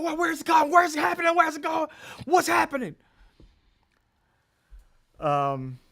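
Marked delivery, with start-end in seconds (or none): 0.0-3.0 high, loud panicked yelling; shrill mock panic, much higher and louder; panicked agents. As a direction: mimic frantic agents shouting in confusion. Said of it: "They're like".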